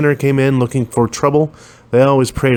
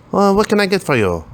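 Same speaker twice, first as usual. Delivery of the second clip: Ling the shopkeeper; 10 semitones higher than usual. A narrator's reading. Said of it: "the woman whose name tag read Ling asked".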